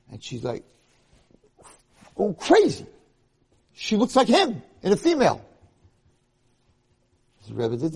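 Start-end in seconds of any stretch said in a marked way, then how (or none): 2.1-5.4 very high, loud cry; shrieked exclamation, pitch way up; frantic outburst. Retold or imitated. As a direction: shout it as if hysterical. Retelling her screaming fit.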